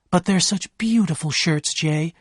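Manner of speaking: lightly voiced tears over beautiful shirts; crying. A narrator's reading. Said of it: "began to cry".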